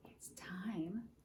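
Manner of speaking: hushed, soft whisper; secretive whisper to listeners; suspenseful whisper. Real, not acted. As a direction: whisper it as if something is about to happen. Hushed suspense as egg hatches.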